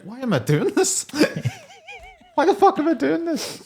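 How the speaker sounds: fed up and cracking up mid-question; laughing exasperation. Retold or imitated. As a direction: pitch soaring high, laughter breaking through. Ghost voiced, laughing.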